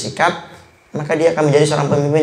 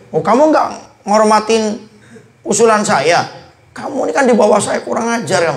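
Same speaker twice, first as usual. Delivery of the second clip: high-pitched indignant rebuke, a touch of anger; offended superior; 7 semitones higher than usual. Retold or imitated. Offended senior snapping at a junior who ignored his suggestion, indignant. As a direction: scold a junior who ignored your idea. Acting out a heated quarrel.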